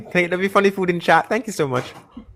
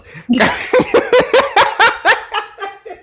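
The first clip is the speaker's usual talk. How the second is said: high burst of laughter. Real, not acted. Streamer cracks up at callers coming one after another, high laughing.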